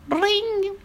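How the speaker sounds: high-pitched imitated bell; doorbell ring. A narrator's reading. Fifi rings at the doctor's door, imitated high ring. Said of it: "Fifi sonna".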